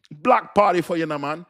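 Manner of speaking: loud, punchy Jamaican imitation; mock Jamaican accent. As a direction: call it out in a playful Jamaican accent. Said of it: "that man tell me Jamaican".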